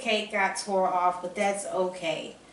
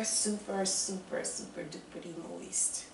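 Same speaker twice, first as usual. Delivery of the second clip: fading to a whisper; 10 dB quieter than usual. Real, not acted. Fading intimate emphasis on moistness.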